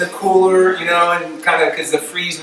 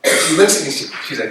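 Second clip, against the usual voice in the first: usual speaking voice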